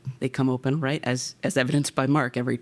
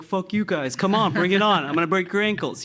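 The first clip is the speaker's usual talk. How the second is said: put-on defiant kid shout; 6 semitones higher than usual. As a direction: shout back at the boys, cocky. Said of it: "and I was like".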